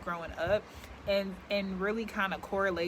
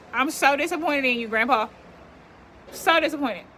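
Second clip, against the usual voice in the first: 1 mock disappointment; 6 semitones higher, 6 dB louder than usual. Real, not acted. Scolding grandpa with the weed whacker.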